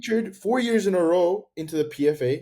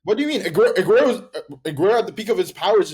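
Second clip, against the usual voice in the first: outraged disbelief; 6 semitones higher, 6 dB louder, faster than usual. Real, not acted. Heated football argument, outraged disbelief.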